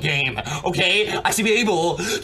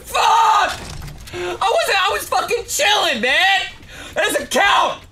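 angry rant; 7 semitones higher than usual. Real, not acted. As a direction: rage at the cheap kill, voice up high. Raging after getting sniped.

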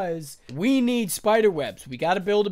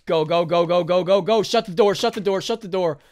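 1 urgent commands; 5 dB louder than usual. Real, not acted. Rushing to shut the door.

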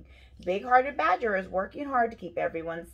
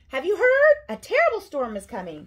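crying out; 8 semitones higher, 10 dB louder than usual. A narrator's reading. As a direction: break urgent news in a high cry. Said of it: "cried Mouse".

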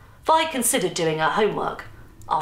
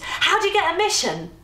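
high-pitched, eager question; enthused; 11 semitones higher than usual. A narrator's reading. Vi asks Nan about getting a mission, enthused. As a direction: ask it keenly, voice jumping up. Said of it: "Vi enthused".

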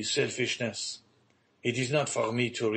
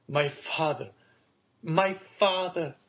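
rising from plea into a high, slow anguished cry; 7 semitones higher, slower than usual. A narrator's reading. Son pleading with his father, high slow anguished cry. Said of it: "cried the young man".

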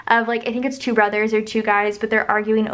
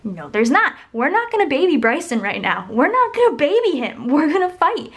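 louder, rising, emphatic protest; re-enacted sibling outrage, climbing higher; retold rage; 5 semitones higher, 4 dB louder than usual. Sister refusing to baby her brother, heated retold rage. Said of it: "I just raged so hard on him".

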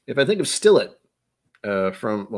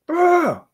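very high, loud comic yell; imitated yell; 5 dB louder than usual. Host imitating abrasive comedian, mock yell. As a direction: burst out in a mock scream. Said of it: "He's a little abrasive".

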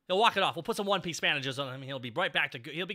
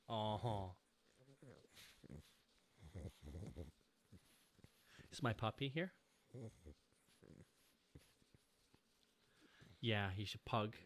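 soft doting voice; 6 semitones lower, 12 dB quieter than usual. Real, not acted. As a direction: coo to the puppy. Talking to dog softly.

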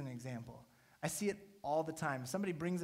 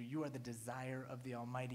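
normal talking voice

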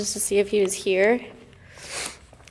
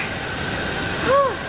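exhausted sigh; 12 semitones higher than usual. Real, not acted. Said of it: "dying over here".

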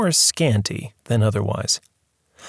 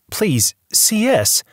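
higher, slow gentle plea; pleading; 10 semitones higher, slower than usual. Acted. Visitor pleads with the upset tycoon, higher and slow. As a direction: coax him to calm down. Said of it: "he pleaded".

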